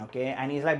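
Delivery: ordinary talk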